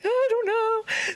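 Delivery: high, slow panicked wail; freaking out. Retold or imitated. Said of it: "literally freaking out".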